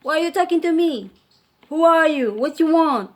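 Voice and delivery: loud, high, fast and rude; high loud rude brush-off; rude retort. Retold or imitated. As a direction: snap back like a rude stranger. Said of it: "some people are just rude".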